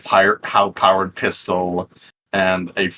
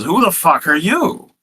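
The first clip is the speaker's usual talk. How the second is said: Fish Guts baffled; 7 semitones higher than usual. Acted. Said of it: "Fish Guts looks at Lucretia and says".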